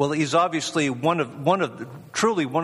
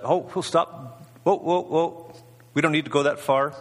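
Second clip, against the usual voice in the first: mock urgent halting; 3 semitones higher than usual. Preacher voicing God stopping the sacrifice, mock urgent. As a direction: call a halt, pitched up, without shouting.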